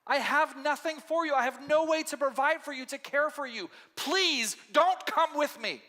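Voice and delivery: a widow begging them to turn back; pleading Naomi. Acted. Naomi begs her daughters-in-law to go home, pleading. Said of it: "but she's like".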